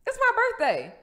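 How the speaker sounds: high, loud mock outrage; indignant protest. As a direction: protest like the wronged birthday girl. Acted indignant self-talk after rejection.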